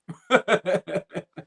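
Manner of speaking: short real laugh. Real, not acted. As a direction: laugh at the joke comment. Reacting to a joke comment.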